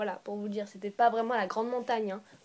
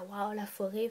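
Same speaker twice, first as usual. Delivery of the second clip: mock awe; 4 semitones lower, 6 dB quieter, slower than usual. Shown as a demonstration. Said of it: "quand je dis la forêt".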